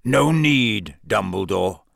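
a hint of Moody's wheezy growl; lightly voiced gruff old auror. A narrator's reading. Moody limping up to Dumbledore, mild wheezy growl. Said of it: "said a wheezy growl".

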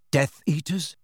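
ordinary delivery

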